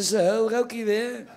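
high slow laughing accusation; mock scolding wife. Comic voicing his wife catching him smoking, slow high. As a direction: stretch it out as the nagging wife.

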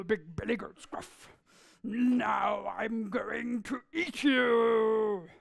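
very slow, drawn-out troll threat; troll threatening the big goat, slow; menacing troll. Acted. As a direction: stretch each word out to menace.

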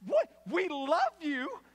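high, loud, indignant echo; mock-appalled sibling, stretching the words; outraged disbelief. Outraged sibling hears lenient parents.

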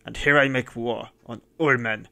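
fierce declaration. A narrator's reading. Madman declares war, very loud.

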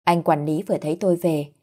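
plain reading voice

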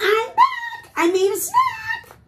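very high, loud squeal; goose voice. Acted. Goose returning to the bear with snacks, squealing goose voice.

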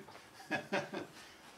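high laugh at own joke; short, high chuckle; real laugh. Real, not acted. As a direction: burst out laughing after the punchline.

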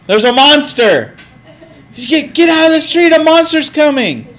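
high urgent warning call; alarmed shouting. Acted. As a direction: scare the kid back to safety. Scaring kid out of street.

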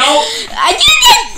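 high laughing shout; laughing triumph. Real, not acted. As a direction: cry it out laughing. After swallowing gross bean.

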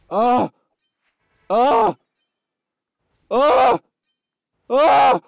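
short, loud, high screams; repeated screams. Real, not acted. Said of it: "Why am I screaming?".

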